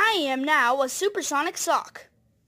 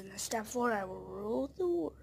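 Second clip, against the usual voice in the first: whispered evil secret, fast; villainous whisper; 5 semitones lower, 12 dB quieter, faster than usual. Acted. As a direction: hiss the last step of the plan softly. Villain whispers final step of plan.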